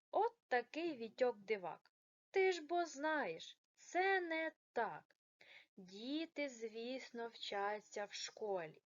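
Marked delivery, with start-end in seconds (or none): none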